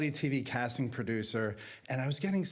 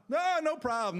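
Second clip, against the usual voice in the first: very high, dismissive brush-off; hammed-up carefree reassurance, pitched way up; mock nonchalance; 3 dB louder than usual. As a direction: breezily wave it away in a high voice. Mock nonchalance about quitting his job.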